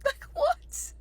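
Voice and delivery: very high squeak of disbelief; incredulous. Retold or imitated. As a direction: squeal it after a laugh, baffled. Disbelief at husband's answer.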